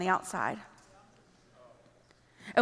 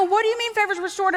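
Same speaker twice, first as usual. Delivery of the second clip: puzzled listener; 7 semitones higher, faster than usual. Acted. Acting puzzled listener's quick reply.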